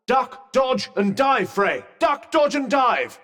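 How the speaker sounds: high, spirited urging; excited coaching. Acted. Cheering partner on in combat.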